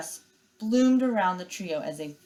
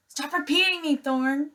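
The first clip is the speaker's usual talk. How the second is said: high, sharp scolding; irritated sister; 9 semitones higher, 4 dB louder than usual. Acted. Nettle scolding Thorn for repeating her, high sharp irritation. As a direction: cut him off, fed up.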